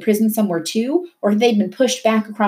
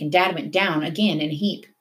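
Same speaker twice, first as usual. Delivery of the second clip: plain reading voice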